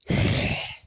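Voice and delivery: a loud unvoiced gasp for air; heavy panting breath. Springtrap arrives winded, loud breathless huff. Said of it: "Why are you out of breath".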